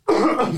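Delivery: sudden choking cough. Real, not acted. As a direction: cough on laughter. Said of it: "Pardon, je m'étouffe". (toux étouffée)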